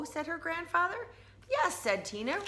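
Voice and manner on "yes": high bright yes; eager yes. Acted. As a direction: chirp it out. Tina confident.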